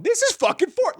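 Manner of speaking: high excited outburst; out loud. Retold or imitated. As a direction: blurt it out loud in disbelief. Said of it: "out loud in the theater".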